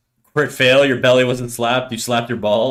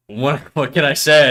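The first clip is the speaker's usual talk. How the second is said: ordinary talk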